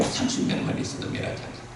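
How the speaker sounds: low, hushed answer; quiet God's reply. Acted. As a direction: answer softly, almost breathed. God answering Abraham.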